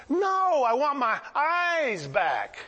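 high, indignant no, then drawn-out plea; emphatic protest. Retold or imitated. Blind man refusing lottery offer, emphatic protest. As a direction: burst in high, then stretch the words.

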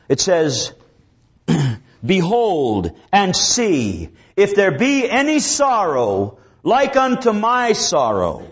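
slow, mournful reading of Lamentations; scripture lament reading. Acted. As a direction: draw the grief out of every line. Reads Jeremiah's lament aloud.

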